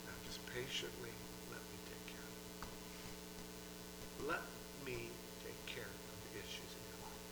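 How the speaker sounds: very soft, near-whispered reassurance; calming Lord. Acted. God calming a troubled spirit, whispered reassurance. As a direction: soothe them, fading into a whisper. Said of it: "calming their spirits".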